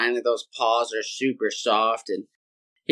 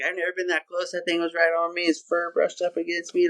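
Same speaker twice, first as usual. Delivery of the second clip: higher-pitched, rattled babble; mock-frightened, raised voice; shaken dad; 4 semitones higher, faster than usual. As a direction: imitate someone spooked recounting a close call. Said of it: "like, shaking".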